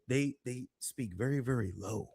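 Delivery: very soft, hushed speech; low-volume talk. Shown as a demonstration. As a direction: drop your volume right down to imitate them. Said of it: "they really talk like this".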